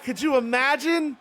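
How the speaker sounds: ordinary delivery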